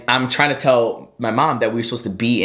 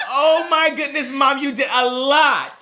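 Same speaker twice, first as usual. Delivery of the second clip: high, slow mock dismay; hammed-up, stretched-out exasperated son; retold exasperation; 13 semitones higher, slower than usual. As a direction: draw each word out high at mom.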